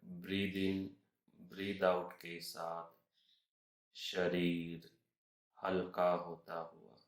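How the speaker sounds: slow, measured meditation voice with long pauses; unhurried relaxation guide, phrase by phrase; guided meditation. Acted. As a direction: guide them through each breath, slowly and evenly.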